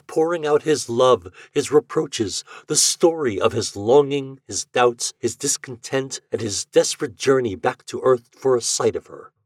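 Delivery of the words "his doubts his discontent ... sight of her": ordinary delivery, nothing marked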